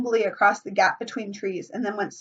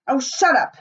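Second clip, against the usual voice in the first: angry snap; 5 semitones higher, 5 dB louder than usual. A narrator's reading. Ralph snaps at Piggy.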